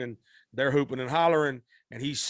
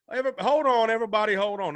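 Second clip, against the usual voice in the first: calling out to the band; 8 semitones higher, 3 dB louder, faster than usual. Retold or imitated. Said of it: "He goes".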